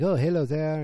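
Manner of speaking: a lightly voiced French father greeting the kids; imitating the dad. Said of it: "the dad is like".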